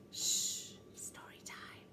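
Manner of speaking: soft whispered shush; gentle storyteller shush; hushing whisper. Acted. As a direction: hush them for story time. Hushing the audience before story.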